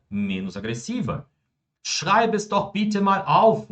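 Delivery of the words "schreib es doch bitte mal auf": high-pitched, slow, over-emphatic order; exaggerated command. Shown as a demonstration. Teacher getting carried away showing students an imperative, exaggerated command. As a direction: overdo the imperative, high and drawn out. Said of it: "agora até eu que exagerei".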